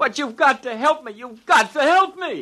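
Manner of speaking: high, whining beg for help; sniveling plea. Acted. Terrified man begging Jake for help, sniveling. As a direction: whimper it out, pleading. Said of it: "And stop sniveling".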